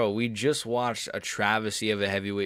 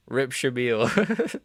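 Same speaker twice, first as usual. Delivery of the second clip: mock mourning; 3 semitones higher, 3 dB louder, much slower than usual. Mock mourning after knockout.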